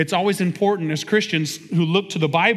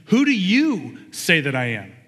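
"you" drawn out and pitched up; stress on YOU. Shown as a demonstration. Jesus putting the question personally to his disciples, stress on "you". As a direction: lean slowly and hard on the word "you". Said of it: "The emphasis is there".

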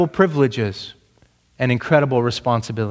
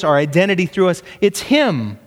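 ordinary talk

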